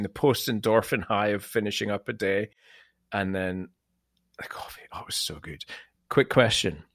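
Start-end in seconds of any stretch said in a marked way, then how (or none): 4.4-5.6 breathy whispered sigh over the coffee; blissful whisper. Retold or imitated. Savouring the coffee memory.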